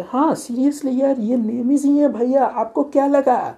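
high, slow mocking falsetto, loud; mocking squeak. Teasing his elder brother on the phone in a mocking squeak. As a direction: squeak it out to tease him.

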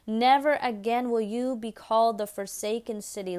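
scripture proclamation. Acted. Proclaims scripture with rising force.

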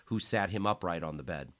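ordinary delivery

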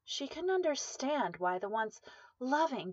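usual speaking voice

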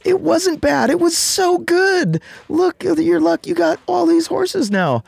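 very high, falsetto-like excited villagers; gushing villagers. Retold or imitated. Villagers to the farmer, squealing very high with excitement. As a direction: squeal with amazement at his luck.

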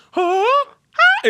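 very high squealed "huh"; squeaky mock high note; mimicked high note. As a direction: imitate a diva's piercing high note. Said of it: "she just went".

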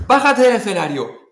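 loud, high exclaimed command; acted-out shout from a worried dad; retold urgent order. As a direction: yell it like an exasperated father. Acts out father's urgent order.

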